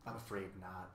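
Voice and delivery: low, soft, sad reply; subdued and sorry. A narrator's reading. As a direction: let the voice sink with regret. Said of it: "he replied sadly".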